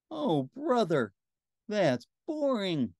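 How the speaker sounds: high, drawn-out groan of boredom; exasperated, bored whine, dragged out; bored complaint. A narrator's reading. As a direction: moan it slowly, sliding down from high. Bored character's groan in story.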